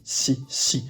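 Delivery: "si" breathed out with no voice, softly; soft whispered si. Shown as a demonstration. Teacher repeats the Korean "si" for the class, whispered. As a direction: whisper the syllable "si" gently. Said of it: "Mais là, c'est bien si".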